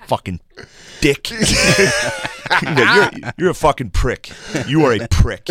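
mock insult as Phil. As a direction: play the grumpy dad cursing at him, half laughing. Friend voices Phil insulting him.

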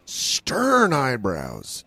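STERN stretched out long and slow; drawn-out emphasis. Real, not acted. Describing the driver's face to his friend, STERN drawn out. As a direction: linger heavily on the word STERN.

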